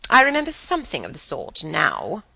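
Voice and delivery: tart aunt's retort; rather tart. A narrator's reading. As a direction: answer with prim, swooping tartness. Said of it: "rather tartly".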